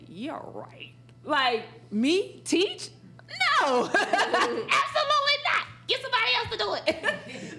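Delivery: high, laughing brush-off; mock disbelieving refusal, giggling.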